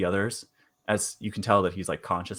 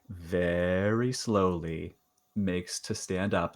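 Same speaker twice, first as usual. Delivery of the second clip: very slow, stretched-out words; drawn-out narration; 3 semitones lower, 3 dB quieter, much slower than usual. Acted. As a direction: stretch VERY SLOWLY to match the movement. Game master mimics slow movement.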